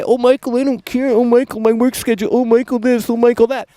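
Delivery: high, nasal-free whiny mimicry of a complaining fan; mocking whiny viewer. Streamer mocking whining viewers in a high whiny voice. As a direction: whine it back like a sulky viewer. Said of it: "and you whine, and you say".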